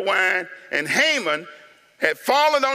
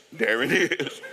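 usual speaking voice

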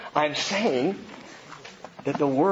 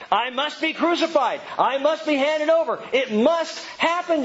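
high-pitched, urgent declaration; preacher voicing a forceful, high-pitched vow; emphatic insistence; 10 semitones higher than usual. Acting out Jesus insisting it must.

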